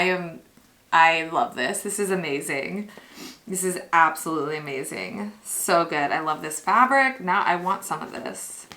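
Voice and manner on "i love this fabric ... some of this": normal talking voice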